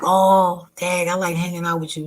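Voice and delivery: hammed-up sweet talk; mock clingy. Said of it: "he was like".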